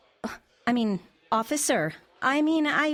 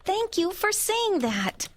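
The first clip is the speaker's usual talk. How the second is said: high, drawn-out grateful agreement; finally vindicated, stretch the words out; exasperated gratitude, pitched high; emphatic relief; 9 semitones higher, slower than usual. Acted. Relieved someone finally agrees with her.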